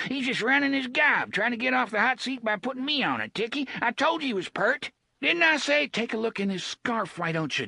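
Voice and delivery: high, quick bluster; a cornered thug pointing at the boy. Acted. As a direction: push the blame away fast and high. Gasher blustering, Jake retorting.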